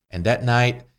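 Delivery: ordinary talk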